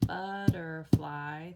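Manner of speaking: "Butterfly" loud, slow, each of three beats hit; teacher claps out "Butterfly" beat by beat; clapping out syllables. Shown as a demonstration. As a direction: clap out "But-ter-fly" slowly and loudly. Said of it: "let's tap it out or clap it out".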